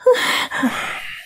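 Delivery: long breathy sigh of despair; weary sigh. Acted. Wife fed up with her gambling husband, heavy sigh. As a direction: sigh out all the hopelessness.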